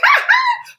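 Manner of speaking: real burst of high laughter. Real, not acted. Laughs at her own remark.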